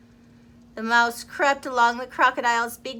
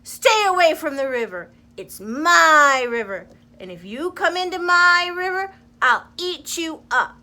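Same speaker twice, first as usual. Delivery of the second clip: loud, higher bullying shout; crocodile shouting; 5 semitones higher, 8 dB louder than usual. Acted. Selfish crocodile shouting at the forest creatures, loud bullying shout. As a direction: bellow a warning to keep off. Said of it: "he shouted to the creatures".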